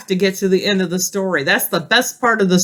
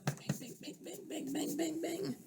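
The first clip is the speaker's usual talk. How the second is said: soft unvoiced bang bang; whispered hammering; 6 semitones higher, 25 dB quieter than usual. Grover nailing the pages together, hushed banging. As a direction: tap out quiet hammer sounds.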